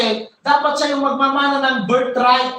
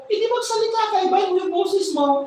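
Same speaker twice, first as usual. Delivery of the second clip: raised higher, eager mother's urging; 7 semitones higher, faster than usual. Retold or imitated. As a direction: coax him, pitched up. Rebecca urges Jacob.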